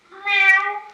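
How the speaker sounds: high, loud playful meow; cat meow. Pretend. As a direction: meow like a kitten. Kitty rhyme ends in meow.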